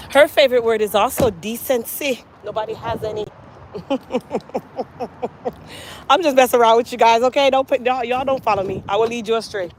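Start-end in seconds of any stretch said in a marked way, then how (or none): 3.7-6.0 long, soft rippling chuckle. Real, not acted. Teasing her friend to the viewers, chuckling. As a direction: laugh quietly at your own joke.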